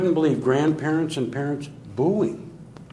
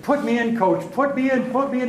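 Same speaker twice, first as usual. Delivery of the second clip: much higher, eager repeated plea; excited kid begging; 11 semitones higher than usual. Retold or imitated. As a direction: bounce with a kid's eagerness, begging the coach. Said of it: "He's all excited".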